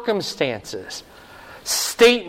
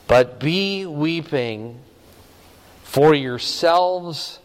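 solemn warning; much slower than usual. Acted. Preacher voicing Jesus' solemn warning.

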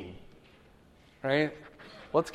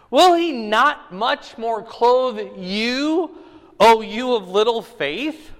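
raised, louder Bible-reading voice, slow at the start; scripture reading; 6 semitones higher, 6 dB louder, slower than usual. Acted. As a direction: proclaim the verses to the room. Reads scripture aloud to class.

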